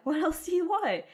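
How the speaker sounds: emphatic repeat. Real, not acted. Repeats the question, rising.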